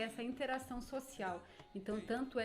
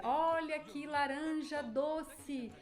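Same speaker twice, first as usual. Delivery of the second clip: slow, drawn-out market cry, higher; street vendor call; 5 semitones higher, 4 dB louder, much slower than usual. Shown as a demonstration. Teacher demos a fruit seller calling to buyers. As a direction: stretch the sales pitch like a fruit seller. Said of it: "as rimas".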